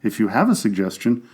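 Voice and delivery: ordinary talk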